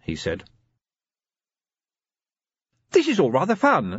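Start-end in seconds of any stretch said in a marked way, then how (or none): 2.9-4.0 cheerful lady. A narrator's reading. Sybil on holiday.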